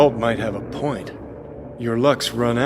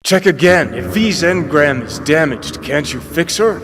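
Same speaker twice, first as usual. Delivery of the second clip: loud, high, pressing insistence; urgent demand; 4 semitones higher, 6 dB louder than usual. Acted. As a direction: push her hard, desperate for an answer.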